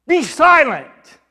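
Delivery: loud, high, drawn-out order; forceful command. Acted. Jesus silencing the demon, forceful shouted command. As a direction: burst out with a slow, booming command. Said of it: "literally in the Greek, what Jesus said is".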